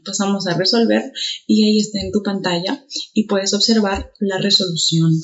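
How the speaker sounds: usual speaking voice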